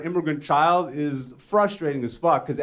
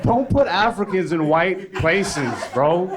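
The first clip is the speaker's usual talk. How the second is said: ordinary talk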